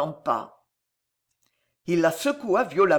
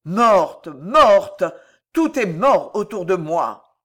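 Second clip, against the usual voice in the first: loud, despairing cry; tragic despair; 8 dB louder, slower than usual. A narrator's reading. Cardinal repeating the death, loud tragic despair. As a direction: wail the loss from the gut. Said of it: "Avec un accent de désespoir tragique".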